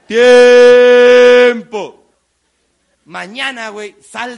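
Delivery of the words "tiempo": very loud shout; shouted stop. Real, not acted. Speaker stopping the crowd exercise, shouted. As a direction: call time like a referee. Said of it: "ends the exercise".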